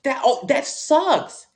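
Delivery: slow, drawn-out high "that sucks"; mock sympathy. Real, not acted. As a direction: stretch it out, fake pity.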